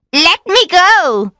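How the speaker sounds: high, loud, drawn-out witch's cry; loud high-pitched plea; desperate cry. A narrator's reading. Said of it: "she cried".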